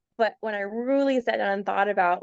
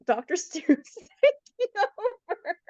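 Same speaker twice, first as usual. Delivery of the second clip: laughing; 8 semitones higher, slower than usual. Retold or imitated. Quoting doctor's text, laughing.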